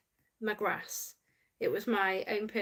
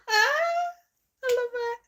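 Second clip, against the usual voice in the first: crying with laughter; 11 dB louder, slower than usual. Real, not acted. Laughing at a funny comment.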